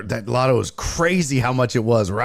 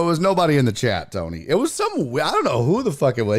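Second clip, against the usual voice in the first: ordinary talk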